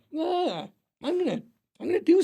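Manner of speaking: mock Andy voice.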